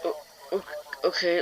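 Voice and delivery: soft, broken stammered okay; deflated okay. Retold or imitated. Busted tenant answering the manager, soft and deflated. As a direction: let the air go out of you. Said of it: "as if her soul had been dragged out".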